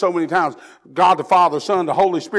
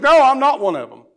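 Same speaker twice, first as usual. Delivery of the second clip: loud denial; 5 semitones higher, 6 dB louder than usual. Retold or imitated. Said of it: "He says".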